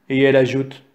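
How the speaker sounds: plain reading voice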